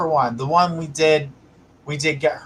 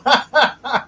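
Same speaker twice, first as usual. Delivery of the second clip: laugh; 9 semitones higher than usual. Real, not acted. Laughs at shower joke.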